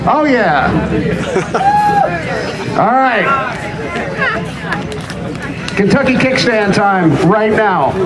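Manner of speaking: ordinary talk, nothing marked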